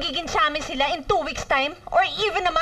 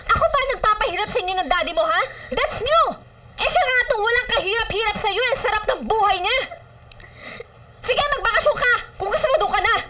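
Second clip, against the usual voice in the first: raised, higher-pitched angry scolding; angry outburst; 5 semitones higher than usual. Acted. Mother fed up with being blamed over Daddy, angry. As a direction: lash out at your child, voice rising. Mother snaps back at daughter.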